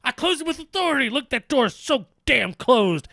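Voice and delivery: high, boastful wrestler voice; proud Batista voice. Retold or imitated. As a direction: brag about closing the door. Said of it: "And he runs back".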